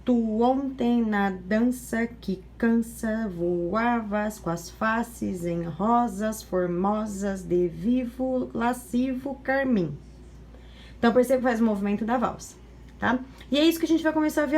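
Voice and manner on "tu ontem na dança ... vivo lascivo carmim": slow, swaying reading like a waltz; slow waltz rhythm. Shown as a demonstration. Teacher showing the waltz movement of the poem, slow swaying reading. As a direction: let each line sway slowly, pra lá e pra cá. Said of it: "é o que o movimento da, do poema faz".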